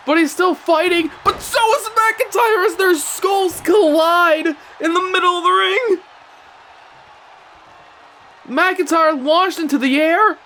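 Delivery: high-pitched, amazed ringside call; excited play-by-play. Real, not acted. Commentator calls wrestlers colliding mid-ring, high and excited. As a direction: ride the climax high, stretching the big words.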